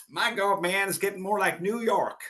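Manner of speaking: high, exasperated star's grumble. Retold or imitated. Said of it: "That was his".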